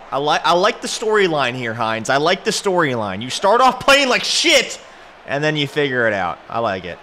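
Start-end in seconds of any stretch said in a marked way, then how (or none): 3.2-4.8 high, loud, drawn-out jab; emphatic outburst. Real, not acted. Gamer ribbing his redeemed receiver, loud emphatic outburst. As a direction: punch it out slowly and loudly. Mock praise of receiver's redemption.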